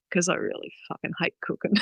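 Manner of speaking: a soft, quick whispered punchline; confiding joke to the host, whispered fast. Retold or imitated. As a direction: drop it to a breathy aside. Joking aside, answer dropped low.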